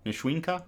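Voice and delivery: Nishwinka, rising, with SHWIN in the middle; questioning pronunciation. Real, not acted. Reader trying a third form of the name, questioning pronunciation. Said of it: "I think it's pronounced".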